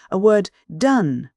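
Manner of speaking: mock-echoing with contempt; scornful echo. A narrator's reading. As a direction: throw his words back at him slowly. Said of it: "she echoed".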